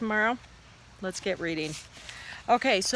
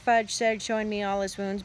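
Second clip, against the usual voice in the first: usual speaking voice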